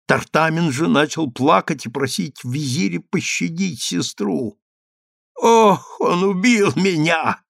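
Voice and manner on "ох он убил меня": high, pained groan; moaning. A narrator's reading. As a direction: wail it as if badly hurt. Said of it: "простонала, тяжело вздохнув".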